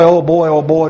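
ordinary talk

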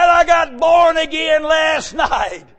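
excited shout. Retold or imitated. Friend's phone call, retold.

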